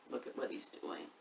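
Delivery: soft conspiratorial whisper; whispered aside. Real, not acted. Pointing out the naughty shadow to young listeners, whispered.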